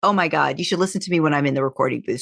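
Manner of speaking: usual speaking voice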